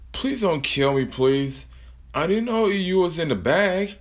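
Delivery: beg, pitch climbing; pleading. Acted. Kelsey begs Plushie.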